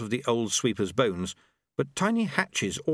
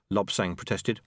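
ordinary delivery

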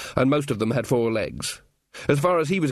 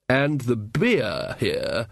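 very slow, drawn-out savouring; relishing wizard; much slower than usual. Acted. Ridcully to companions about Lancre beer, slow relish. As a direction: stretch each word in fond anticipation.

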